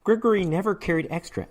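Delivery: usual speaking voice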